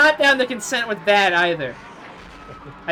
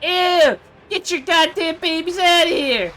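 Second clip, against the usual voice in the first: loud, high disgusted shout; disgusted yell; 7 semitones higher, 4 dB louder, slower than usual. Real, not acted. Disgusted yelling at alien babies.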